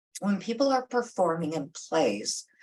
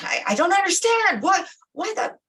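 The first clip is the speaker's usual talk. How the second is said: high, loud indignant wail; screaming narcissist; 12 semitones higher, 5 dB louder, faster than usual. Retold or imitated. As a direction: play the wounded narcissist yelling back. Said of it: "they're saying".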